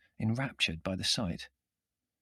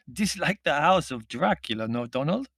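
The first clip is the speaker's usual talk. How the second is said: put-on high, accented questioning voice; Middle European visitor; 5 semitones higher, 3 dB louder than usual. A narrator's reading. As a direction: exclaim in a foreign accent, lifting at the end. Foreign figure addresses his English companion.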